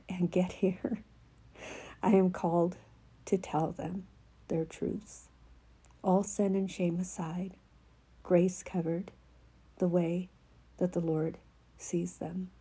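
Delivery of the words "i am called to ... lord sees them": hushed, half-whispered reading of a prophetic word; soft devotional reading. Acted. Reading her written word to a child of God, softly half-whispered. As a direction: read it softly as the Lord's voice, tender and unhurried.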